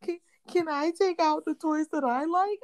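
put-on high, small child's plea; little boy voice. Mom imitates her little son asking for his toys, high childlike plea. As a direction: ask like a timid little boy.

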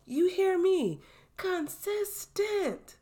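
CONSISTENT very high and slowly stretched; emphatic. Real, not acted. Host driving it home to listeners, high drawn-out CONSISTENT. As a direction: push CONSISTENT way up high, syllable by syllable.

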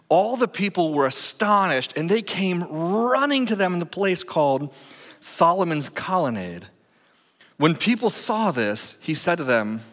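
proclaiming scripture to the congregation; scripture reading. Acted. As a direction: read the passage out with weight. Reads scripture aloud.

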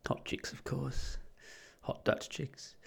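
breathy whisper; whispered aside. Pretend. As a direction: whisper it like a cheeky secret. Aside about women.

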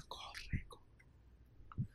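whisper. Real, not acted.

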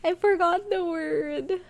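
high, slow, whiny mock sob; mock whine. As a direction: whimper it like a sad child. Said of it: "So sad".

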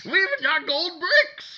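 loud, high manic pitchman; unhinged villain. Pretend. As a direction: yell it like a lunatic. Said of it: "We both sound like madmen".

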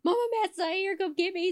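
high whiny mock wife voice; panicked wife calling mama. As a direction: whine it up high like a frantic wife. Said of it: "She'd call her mama".

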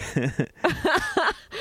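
squealing burst of laughter; high laugh. Real, not acted. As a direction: shrieks with laughter. Laughs at the joke.

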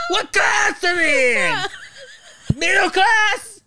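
high, loud, drawn-out Bronx screech; Italian Bronx woman. Acted. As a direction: scream like a hammed-up Italian Bronx mom. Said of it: "sounded like an Italian Bronx woman".

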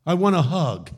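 high, loud voice of the street man. Retold or imitated. Homeless man asks the preacher for a hug, high and loud. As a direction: squeak it up, then a loud ask. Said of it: "He said".

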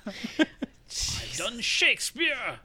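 Kenneth Branagh. Acted. Branagh regretting Wild Wild West, slow mock-tragic lament. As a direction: draw it out like a wounded thespian. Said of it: "I would like to present you Kenneth Branagh".

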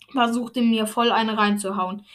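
plain reading voice

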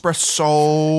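slow, drawn-out Philly "Espresso"; Philly accent. Retold or imitated. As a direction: stretch "Espresso" Philly-style. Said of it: "my Philly on impression".

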